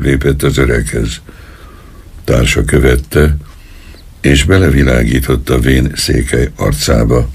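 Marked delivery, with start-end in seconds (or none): none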